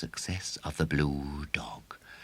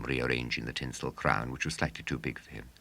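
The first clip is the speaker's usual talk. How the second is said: ordinary delivery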